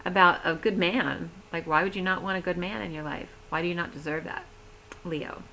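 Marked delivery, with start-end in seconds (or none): none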